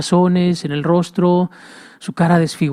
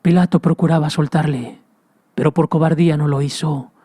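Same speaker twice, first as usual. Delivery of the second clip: ordinary delivery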